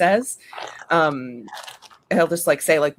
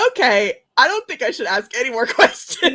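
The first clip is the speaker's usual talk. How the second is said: higher, louder, laughing line; 6 semitones higher, 7 dB louder than usual. Real, not acted. Aunt laughing while retelling her reply. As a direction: talk through giggles.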